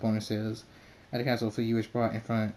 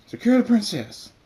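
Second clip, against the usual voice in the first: high, loud barked order; guard's shout; 11 semitones higher, 10 dB louder than usual. A narrator's reading. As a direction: yell it as a soldier storming in. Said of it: "one says".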